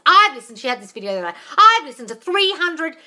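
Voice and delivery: loud, mocking imitation of Candace bragging. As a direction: ham up the know-it-all. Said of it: "she's going".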